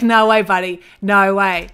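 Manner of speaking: loud, amused imitation of a bouncer; mock refusal. Bouncer refusing a man after taking cash, mock loud. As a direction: playfully turn someone away at full volume.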